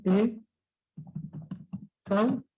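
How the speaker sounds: "E, sau" split into separate syllables with pauses. Shown as a demonstration. Teacher splitting exhausto into syllables, paused. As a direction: spell out "E" then "sau" slowly. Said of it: "Sería así".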